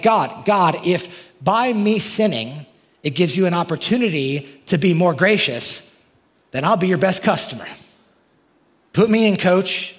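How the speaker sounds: hammed-up sinner pleading with God; eager sinner. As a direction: pitch it like a keen recruit. Imitating a sinner's bargaining logic.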